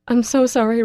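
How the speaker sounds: high, loud tearful sorry; tearful apology. A narrator's reading. Girl crying her apology to Russell, tearful. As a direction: apologize through tears, not quite whispering. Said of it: "I whisper as hot tears".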